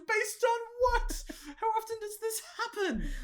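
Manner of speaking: mock incredulity. Mocking the bookie's odds, shrill disbelief. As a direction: squeak it in outraged disbelief.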